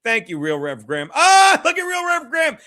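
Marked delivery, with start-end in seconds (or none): 1.1-2.6 delighted greeting. Real, not acted. Delighted at a chat donor.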